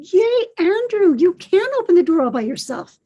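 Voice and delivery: high, loud cheer; celebrating. A narrator's reading. Grammy jumps and claps for her grandson, celebrating. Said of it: "laughing in celebration".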